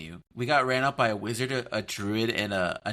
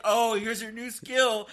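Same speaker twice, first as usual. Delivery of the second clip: mocking wizard; 9 semitones higher, slower than usual. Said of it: "you come in a wizard hat and say".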